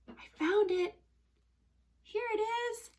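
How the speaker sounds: high, light announcement; delighted discovery. Pretend. Teacher finds her toy horse, high delighted voice. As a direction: reveal a little treasure.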